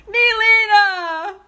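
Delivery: loud, high drawn-out call. Real, not acted. Calling out to Nelina in the room, loud and high. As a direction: call the name across the room.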